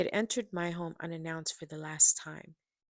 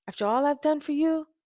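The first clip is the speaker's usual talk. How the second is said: loud, high, affronted question; indignant protest; 10 semitones higher, 11 dB louder than usual. A narrator's reading. Man being dumped by his ex, indignant protest. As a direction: protest the betrayal at full voice. Acted quarrel, ex being thrown out.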